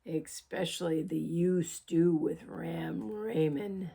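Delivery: lightly voiced wolf, low and fond; growled wolf. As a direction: purr it low into her ear. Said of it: "he growled in the other ear".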